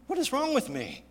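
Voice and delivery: high-pitched, exasperated self-questioning; self-reproach. Retold or imitated. Father catching himself angry at his hurt son, self-reproachful. Scolding himself in retold thought.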